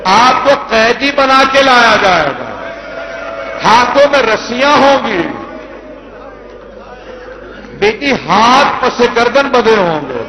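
high, loud grieving cry building to the climax; wailing lament. Acted. Reciter voicing Ali's farewell to Zainab, loud wailing. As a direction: cry it out to stir the mourners.

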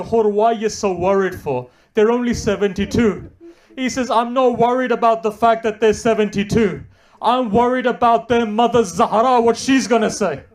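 preacher voicing Hur's dread; impassioned preaching. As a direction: drive the worry home loudly. Preacher voices Hur's fear.

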